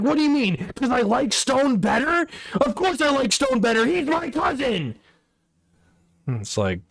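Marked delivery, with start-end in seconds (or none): 0.0-5.0 high-pitched, whiny mimicry of an offended guy; mock his outburst way up high; mock indignant friend.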